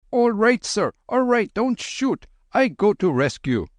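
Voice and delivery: high yelping Babu plea; panicked Babu. Acted. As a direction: call out desperately, high. Babu calling to Frenchman.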